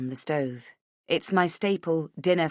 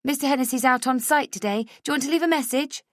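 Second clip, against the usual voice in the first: higher, fast receptionist voice; brisk receptionist; 9 semitones higher, 3 dB louder, faster than usual. A narrator's reading. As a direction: rattle it off like a busy receptionist. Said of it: "she asks".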